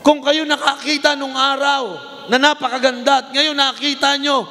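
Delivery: climbing high, insistent rebuke; rising rebuke. Acted. Preacher rebuking the congregation in God's voice, rising and insistent. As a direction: press them, voice rising each line.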